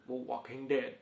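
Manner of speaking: put-on "The Walking Dead" in an English accent with no L in Walking; lightly mock-English "The Walking Dead"; English accent like Yannick. Still doing his friend Yannick for viewers, an English accent. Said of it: "on prononce pas le L".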